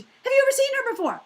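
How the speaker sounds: high, loud, fast disbelieving question; astonished question. Retold or imitated. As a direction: fire the question out in amazement.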